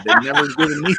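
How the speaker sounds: line squeaks up and dissolves into laughter; can't finish for laughing. Real, not acted. Telling stream friends the cereal punchline while laughing.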